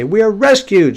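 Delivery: high, loud triumphant claim; mock jubilant cry, pitched well up; exclamatory quote. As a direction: proclaim it like people sure of rescue. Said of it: "an exclamation point".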